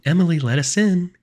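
high, loud call; loud, high-pitched sing-out; insistent demand. A narrator's reading. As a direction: demand entry through a door. False sister demands entry, banging.

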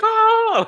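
very high, loud mock shriek; the cousin shrieking in panic, hammed up; cousin's scream. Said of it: "He was screaming too".

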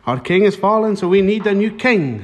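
put-on Irish newsreader accent; mock Irish bulletin voice; Irish news presenter. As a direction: deliver the headline as a hammed-up Irish anchor. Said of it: "we'll go over to Irish news".

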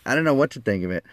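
plain reading voice

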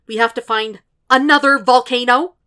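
holding back a shout, failing; barely restrained outburst. A narrator's reading. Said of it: "his voice barely restrained".

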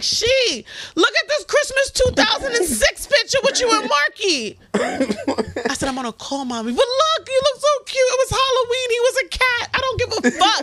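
mother gushing over pictures; excited mother. Retold or imitated. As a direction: coo over old photos. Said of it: "She's like".

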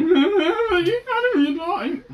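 high, slow cracking voice with a laugh; voice breaking. Real, not acted. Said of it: "That was a nice voice break".

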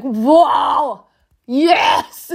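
loud, excited wow yes; inner cheer. Retold or imitated. Her secret inner reaction to free chips, cheering. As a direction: let your inner joy burst out. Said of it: "inside I was like".

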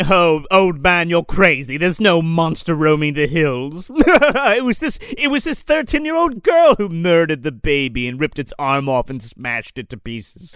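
hammed-up courtroom ridicule; mocking courtroom voice. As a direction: sneer at the old man, laughing. Courtroom mocking the old farmer.

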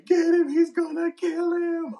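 high, loud mock hysterical shriek; mock panicked shriek. Retelling the girlfriend yelling at the bouncer, high mock shriek. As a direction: play the screaming girlfriend, shrill panic. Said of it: "She's going to me".

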